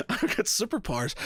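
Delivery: high, laughing mock triumph; retold delight bubbling into laughter; gleeful realisation. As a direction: announce it like a giddy new superhero.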